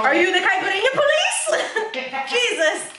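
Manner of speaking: teasing friend, laughing; laughing tease. Real, not acted.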